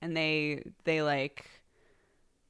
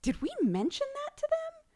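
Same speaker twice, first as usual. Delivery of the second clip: much higher, squeaky mock wonder; mock puzzled voice. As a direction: play the baffled parents, pitched way up. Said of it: "Sam and I were going".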